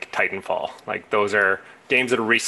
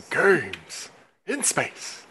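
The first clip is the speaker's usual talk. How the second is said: higher, drawn-out title call; mock show-title echo; 5 semitones higher, much slower than usual. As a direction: echo the title with playful, stretched-out grandeur. Echoing the drawn-out title rendition.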